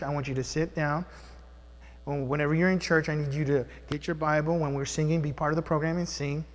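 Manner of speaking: father patiently instructing son Zeke in church; gentle fatherly. Retold or imitated. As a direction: calmly teach your son. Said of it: "It was okay sometimes to tell him".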